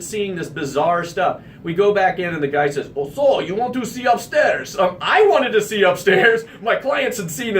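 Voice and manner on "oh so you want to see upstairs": lightly put-on Russian accent; a hint of a Russian homeowner at the door. Shirtless owner greeting the realtor in a light Russian accent. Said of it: "thick Russian accent".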